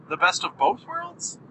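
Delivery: rising question tune at the end; uptalk. Real, not acted. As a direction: let worlds lift up like a question. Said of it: "My voice went up at the end?".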